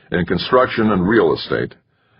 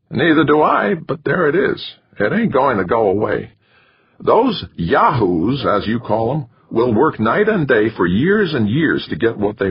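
wiry manager voice; 6 semitones higher than usual. A narrator's reading. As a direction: lay it out to him like a lecture. Narrator voicing McChesney.

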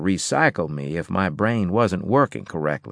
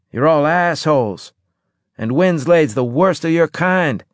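loud, slurred and higher; slurred speech; 8 semitones higher, 5 dB louder than usual. A narrator's reading. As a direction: slur it out, groggy and angry. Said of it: "your slurred words".

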